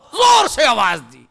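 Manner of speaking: shouted high and at full force; loud shout. Shown as a demonstration. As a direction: bellow it out, pitched high. (زور سے بلند آواز میں)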